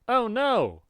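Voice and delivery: a sudden high-pitched "oh no"; alarmed cry. Acted. Reading the comic aloud, a high alarmed cry. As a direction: voice a character caught off guard. Reads character's panicked exclamation.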